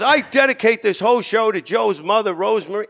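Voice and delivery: showman dedication. Retold or imitated. Host dedicating the show to the mother, grandly. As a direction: announce it like a lounge host. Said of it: "He goes".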